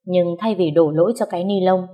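usual speaking voice

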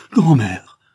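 loud exclamation of horror, falling away; cry of dread. A narrator's reading. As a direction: exclaim in frightened recognition. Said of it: "s'écria-t-elle avec effroi". (exclamation pleine d'effroi)

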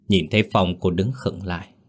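usual speaking voice

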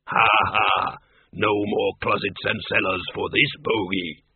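low monster voice with a big "ha, ha"; gloating bogeyman. A narrator's reading.

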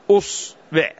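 أصبع opening on a short u, "us-"; damma on hamza. Shown as a demonstration. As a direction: say أصبع as "us-bu'", split in two. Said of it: "ائت بها مضمومة".

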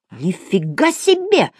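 slow, high, drawn-out exclamation; stretched pupil's cry of protest; outraged wail. A narrator's reading. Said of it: "завопил Градусов".

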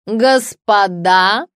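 loud, long-drawn public appeal; declamatory cry. A narrator's reading. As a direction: call out to the whole room, stretching the word. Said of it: "закричала писательница".

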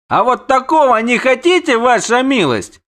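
captain's high, loud retort; defiant shout. A narrator's reading. Captain refuses his lord with mocking defiance, shouting. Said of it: "крикнул капитан".

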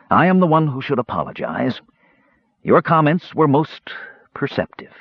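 high-pitched, halting delivery; contrite mage. A narrator's reading. Mage conceding to his twin after being wounded, pained. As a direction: pitch it up and pause before the last word.

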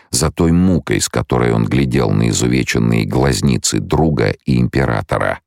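usual speaking voice

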